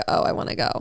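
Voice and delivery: soft whispered imitation. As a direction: breathe it out like a secret. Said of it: "you'll be like".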